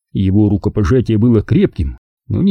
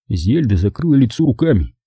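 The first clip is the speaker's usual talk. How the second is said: plain reading voice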